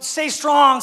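sustained high exhortation; rallying call. Acted. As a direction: call it out to the crowd. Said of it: "Someone say".

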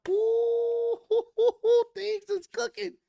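very high falsetto whoop with laughter; gleeful excitement. Retold or imitated. Fan reacts to backstage drama heating up, high gleeful squeal.

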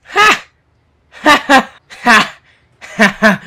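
loud, high barking "Ha!" laughs, one after another; mock gloating laughs. Gloating to the game about the title, loud mock laughs. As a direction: cackle in triumph, hammed up.

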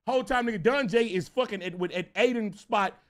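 ordinary talk

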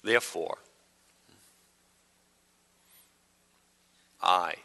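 painfully slow, low-key Bible reading; exaggerated slow reading. Shown as a demonstration. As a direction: read it absurdly slowly, long pause between words. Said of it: "I'll read slow".

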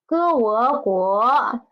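歌我国 drawn out slowly, syllable by syllable; slow model pronunciation. Shown as a demonstration. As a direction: say 歌我国 slowly so students can copy each sound. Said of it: "next new word is".

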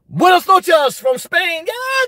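high, whooping welcome; real excitement, pitch leaping up; excited shout-out. Real, not acted. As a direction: greet a viewer with a rising, drawn-out cheer. Excited greeting to Spanish viewer.

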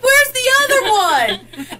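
high, loud, puzzled question; imitating the shopkeeper's surprise; surprised shopkeeper. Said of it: "he's like".

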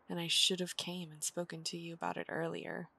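normal talking voice

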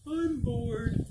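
a touch of a bored cow. Acted. One cow to another, mildly bored. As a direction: drag it out like a fed-up cow. Cows complaining in story.

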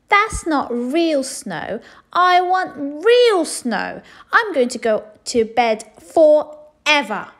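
loud, high, slow tantrum; shouting. A narrator's reading. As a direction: stamp the words out. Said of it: "she shouted".

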